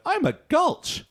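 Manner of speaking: put-on high, slow childlike voice; high, slow dim little boy; Ralph voice. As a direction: play a dopey little kid, high and slow. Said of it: "I did like Ralph's, uh, line of".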